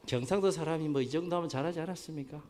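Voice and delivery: ordinary talk, nothing marked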